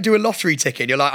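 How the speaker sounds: ordinary talk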